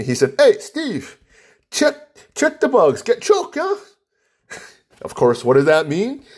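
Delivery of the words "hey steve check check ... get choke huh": Hawaiian Pidgin, pitched much higher. Retold or imitated. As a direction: imitate the Pidgin-speaking contractor in a higher voice. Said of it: "he broke it out with me".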